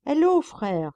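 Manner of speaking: high, loud hail; calling out. A narrator's reading. Monk calls to his companion, high loud hail. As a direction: hail the brother eagerly from afar. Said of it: "cria le moine".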